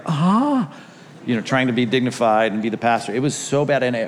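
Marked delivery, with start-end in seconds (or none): 0.1-0.7 high, pained "ah" of a turned ankle; mock yelp of pain, pitched up; pained cry. Pastor reenacting rolling his ankle off the curb, pained cry. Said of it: "one of those where you're like".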